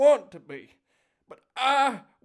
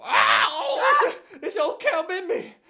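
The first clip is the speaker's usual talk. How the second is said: startled yelp; 8 semitones higher, 8 dB louder, slower than usual. Acted. Bitten by zombie grandma.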